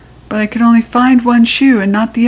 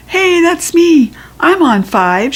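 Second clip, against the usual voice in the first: put-on louder, higher boy's shout; shouted claim; 8 semitones higher, 4 dB louder, slower than usual. A narrator's reading. Red-haired boy shouting that he won, a little louder. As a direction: claim the prize eagerly. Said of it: "shouted a boy".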